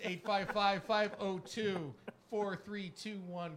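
reading the digits slowly and clearly; slow number read. Shown as a demonstration. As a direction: spell out each number for callers. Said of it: "reading out phone number slowly".

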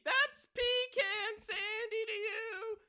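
slow, high, sung boast; sing-song robber. Acted. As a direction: croon his own name. Robber corrects host.

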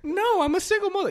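very high, loud put-on voice; mock single mother. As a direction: protest as an offended mother in a comedy bit. Plays the offended single mother.

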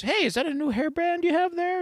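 high, rising retold question; mock-interested father noticing something new; curious dad. Father asks daughter about hairband, high curious voice.

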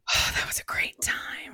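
breathy nostalgic whisper. Real, not acted. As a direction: whisper it fondly, remembering. Fond memory of snow week.

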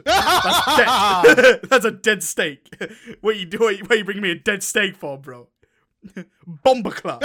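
loud British slang, cracking up; hammed-up British complaint through bursts of laughter; British slang accent. Podcaster plays diner rejecting dead steak, laughing British slang accent. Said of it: "imagine that in a British accent, be like".

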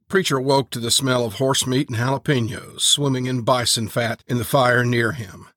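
usual speaking voice

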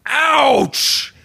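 high, imagined yelp of pain; mock pained yelp. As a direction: yelp "ouch" high, hammed-up. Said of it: "being like".

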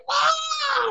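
high shrieked wow; piercing mock yelp; mock scream. Shown as a demonstration. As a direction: scream it like a startled lunatic. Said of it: "I'm not gonna scream like".

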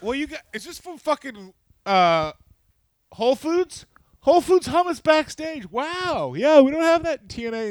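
high cartoon amazement; mock amazed wrestler. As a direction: gush over fancy hummus. Outlaws marvel at WWE catering.

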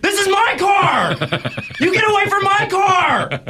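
high, slow indignant shout; shouting back. Retold or imitated. Host yells back at the old lady, high and indignant. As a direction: yell it back at her, outraged and certain. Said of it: "And I'm like".

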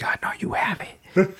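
a soft voiceless whisper in the dark; hushed menacing whisper; creepy whisper. Retold or imitated. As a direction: breathe the threat into his ear.

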